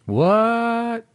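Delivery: high, drawn-out "what"; mock surprise. Co-host hearing there's no episode this week, high mock surprise. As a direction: feign shock, stretching it up high.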